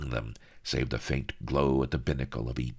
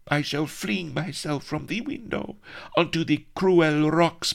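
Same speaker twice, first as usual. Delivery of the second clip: melodramatic damsel; 11 semitones higher than usual. Acted. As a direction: wail it as a doomed heroine. Heroine melodramatic despair.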